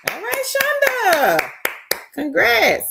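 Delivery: high, bright-voiced cheer for a friend; excited cheer. Real, not acted. Host cheering a viewer making four hundred a week, excited. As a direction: squeal her congratulations.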